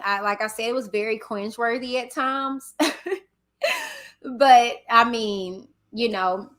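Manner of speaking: ordinary talk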